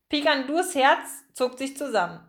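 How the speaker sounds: usual speaking voice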